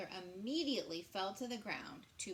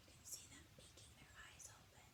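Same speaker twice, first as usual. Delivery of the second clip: whisper; 16 dB quieter, faster than usual. Real, not acted. Teacher whispering about the possums peeking. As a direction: whisper it so they won't hear.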